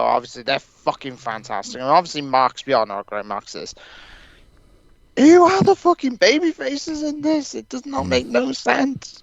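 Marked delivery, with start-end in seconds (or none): 5.2-9.2 high, incredulous outburst; exasperated rant. Real, not acted. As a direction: throw up your hands in disbelief. Exasperated rant about baby faces.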